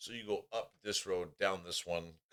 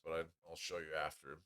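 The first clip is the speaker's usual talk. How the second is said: ordinary delivery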